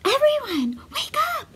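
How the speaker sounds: high, bright wake-up call; excited call. Acted. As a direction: rouse them all with joy. Dasher rouses her family.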